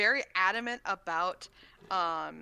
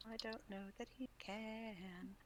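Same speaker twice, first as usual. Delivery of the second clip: whisper; 15 dB quieter than usual. Real, not acted. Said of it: "whispered aside".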